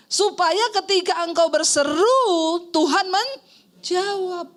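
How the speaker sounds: high, drawn-out preacher's proclamation; emphatic preaching. Acted. Pastor promising the congregation God will answer, emphatic preaching. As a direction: stretch the key words and proclaim slowly.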